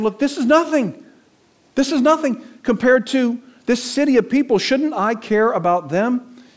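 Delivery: high, forceful rebuke; a touch of rebuking God. Acted. God pressing Jonah about the city, high and firm. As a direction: lift the voice and press the point.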